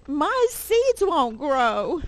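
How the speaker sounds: high, drawn-out storybook wail; complaining Toad. Acted. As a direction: wail it slowly in mock distress. Toad wails to Frog.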